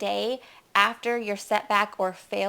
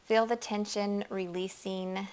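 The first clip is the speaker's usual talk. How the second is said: guided meditation; 4 dB quieter than usual. Acted. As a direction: ease them into relaxing, hushed and unhurried.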